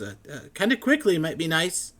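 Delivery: high, loud, flustered scholar voice; excited scholar. Acted. Matrius haggles.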